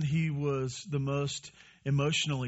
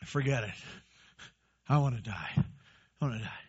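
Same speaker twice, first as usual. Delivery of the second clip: sinking lower into mock despair; hammed-up despair; 4 semitones lower, 5 dB quieter than usual. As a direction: play the prophet's melodrama, letting it drop. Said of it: "Pretty dramatic, huh?".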